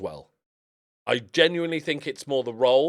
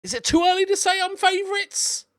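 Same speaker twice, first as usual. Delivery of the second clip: much higher, giddy boast; high, excited tease to the viewers; cheeky excitement; 4 dB louder than usual. Real, not acted. Cheeky boast after big win.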